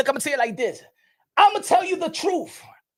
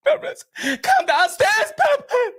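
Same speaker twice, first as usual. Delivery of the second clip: calling out; 9 semitones higher, much slower than usual. Pretend. Calling Papoose down, mock summons.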